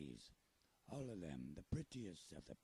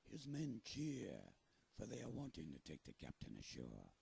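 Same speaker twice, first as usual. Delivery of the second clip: pirate voice; 4 dB louder than usual. Acted. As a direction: talk like a salty pirate storyteller. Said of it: "Do you think I sound like a pirate?".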